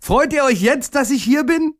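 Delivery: put-on very high, hopeful childlike question; high, delighted little voice; excited call. A narrator's reading. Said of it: "rief der kleine Tag".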